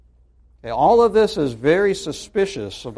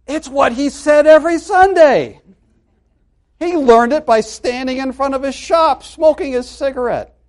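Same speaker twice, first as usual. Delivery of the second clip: indignant realization; 11 semitones higher, 8 dB louder than usual. Retold or imitated. Couple realizing the trick, indignant.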